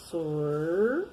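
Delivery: "Sor" with tongue curled back on the R. Shown as a demonstration. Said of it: "a gente dobra a língua". ("Sor" com a língua dobrada)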